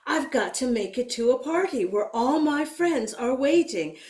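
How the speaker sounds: ordinary delivery, nothing marked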